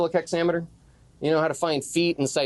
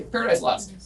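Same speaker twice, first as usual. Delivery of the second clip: usual speaking voice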